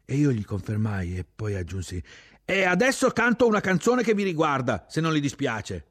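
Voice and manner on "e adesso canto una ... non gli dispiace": very high, loud yell, easing at the end; shouted announcement. A narrator's reading. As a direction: shout it over the motors, then ease off. Shouting over engines to officer.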